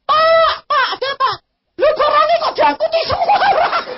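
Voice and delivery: high, loud startled calling; alarmed maid. Retold or imitated. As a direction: wake the master in a panic. Maid waking master in alarm.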